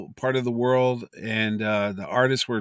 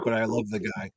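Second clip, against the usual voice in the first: ordinary talk, nothing marked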